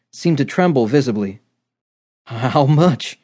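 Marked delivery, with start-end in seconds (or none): 2.3-3.2 hoarse, nervous little man. A narrator's reading. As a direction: rasp it out, trembling. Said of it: "he asked, hoarsely".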